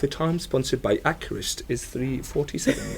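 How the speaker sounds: posh British speaking-clock announcer, crisp and formal; posh British accent. Retold or imitated. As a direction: read it like the old British talking clock. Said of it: "I've got a quintessentially British one as well".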